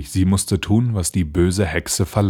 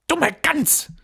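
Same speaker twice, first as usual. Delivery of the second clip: very high-pitched witch insult; scolding old witch. Acted. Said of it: "sagte die Alte". (schimpfende alte Hexe)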